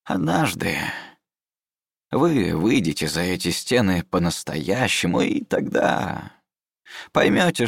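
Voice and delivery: put-on slow, pedantic old scholar; old mentor voice.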